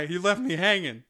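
laughing, loud mock wail; mock outraged cry. Repeated complaint, louder with laugh.

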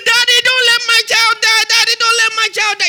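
high loud wailing plea; begging prayer. Retold or imitated. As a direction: beg desperately like a helpless child. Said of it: "Don't say".

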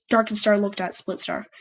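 usual speaking voice

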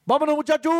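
high, excited rallying shout; hammed-up show kickoff; hype call. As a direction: fire up the crew to start. Host kicks off the show.

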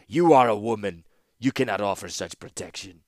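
loud, different man's voice; a big warrior dismissing her; Falaji leader voice. Acted.

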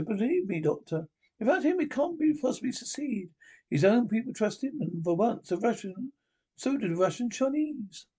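high, prim and imperious; Margaret Thatcher impression. Prime Minister scolding the Doctor, high and prim. Reader voices Miss Thatcher's lines.

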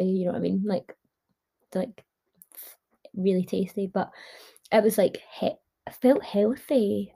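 ordinary talk, nothing marked

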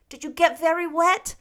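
anxious. A narrator's reading. Diana anxiously asking Anne if she got wet. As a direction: ask with real concern. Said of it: "she asked anxiously".

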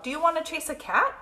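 high-voiced dog character; storybook dog. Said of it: "barked the dog".

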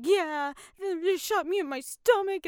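high indignant grievance; angry complaint. Retold or imitated. On the phone to her dad, a high, outraged complaint. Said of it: "I was just so angry".

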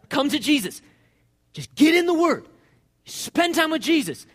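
high, emphatic urging; eager, high-pitched invitation; urgent coaxing. Acted. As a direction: coax them over, pitched well up. Acts urging new believers.

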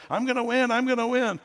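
put-on high, loud gleeful kid cheer; excited child. Grandsons cheering their stick boats on at the pond, high excited shout. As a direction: shout it like a kid winning a race.

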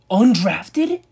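a loud, high-pitched shocked yelp; stunned exclaim. Real, not acted. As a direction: blurt it out in disbelief. Reads he went undrafted.

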